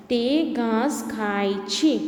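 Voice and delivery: તે ઘાસ ખાય છે read word by word, slowly; slow reading aloud. Shown as a demonstration. Teacher reads the sentence slowly to pupils. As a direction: read it out slowly like for small children. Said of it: "વાક્ય વાંચીશું".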